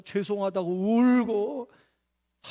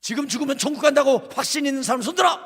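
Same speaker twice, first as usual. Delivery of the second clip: high, loud revival preaching; fiery shouted sermon; full-force preaching shout; 11 semitones higher, 7 dB louder than usual. Retold or imitated. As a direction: thunder it at the kids from the pulpit. Preacher shouting at youth retreat.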